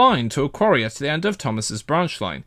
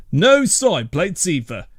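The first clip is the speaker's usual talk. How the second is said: ordinary delivery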